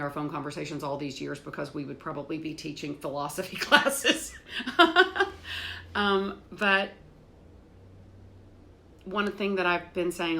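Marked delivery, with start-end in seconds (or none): 4.4-5.9 high, breathy rising laugh. Real, not acted. Laughing about phone talks with her mom. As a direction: laugh at your own joke.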